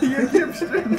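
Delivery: laughing call. Retold or imitated.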